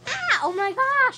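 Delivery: sudden high loud gasp of surprise; real shock, shrieking; startled shriek. Real, not acted.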